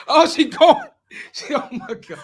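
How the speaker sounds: cracking up, laughing hard; laughing through the words. Real, not acted. Host cracks up at dropped guest.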